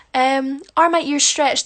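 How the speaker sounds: ordinary delivery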